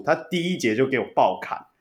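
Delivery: ordinary delivery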